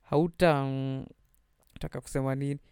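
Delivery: ordinary delivery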